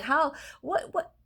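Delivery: high, stunned "how, what"; lightly mocking her own confusion; baffled disbelief. Staring at the popcorn ceiling, baffled disbelief. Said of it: "I'm like".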